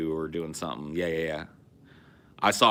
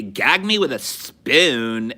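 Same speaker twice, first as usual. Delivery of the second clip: put-on slow, drawn-out Valley Girl "Gag me with a spoon", louder; mock Valley Girl; 4 semitones higher, 7 dB louder, much slower than usual. Mocking the Valley Girl catchphrase for the co-host, hammed-up Valley Girl. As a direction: stretch every word like a Valley Girl. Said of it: "But they were like".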